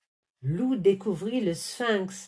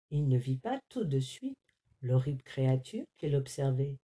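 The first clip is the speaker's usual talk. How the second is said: plain reading voice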